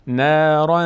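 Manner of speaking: "نارا" ending in a held nasal hum; hidden nasal tanween. Shown as a demonstration. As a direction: let the end of "نارا" ring in the nose. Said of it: "صدا در بینی میفته".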